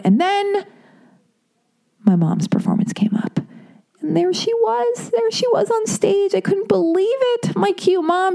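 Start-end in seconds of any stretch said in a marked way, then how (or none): 4.0-8.3 lifted high and slowed in wonder; awed and high. Real, not acted. Daughter seeing mom on stage, high and awed. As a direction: voice rising in awe at the sight.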